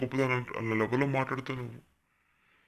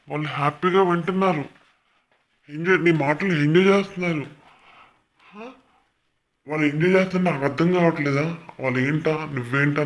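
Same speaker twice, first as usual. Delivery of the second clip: angry scolding; 3 semitones higher, 6 dB louder than usual. Real, not acted. Scolding a YouTuber's audience.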